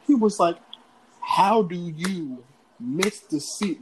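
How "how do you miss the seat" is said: imitating an incredulous dad, very slowly; slow disbelief. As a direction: spell the question out word by word. Dad's question, slowed right down.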